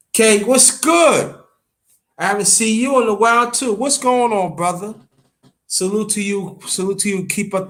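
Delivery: real stream hello; excited greeting. Real, not acted. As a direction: hail him like a long-lost friend.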